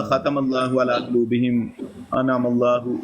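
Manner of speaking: slow, lightly chanted verse as he recited it; Quranic recitation. Retold or imitated. Retelling the opponent's recitation, slow light chant. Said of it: "تو اس نے کہا".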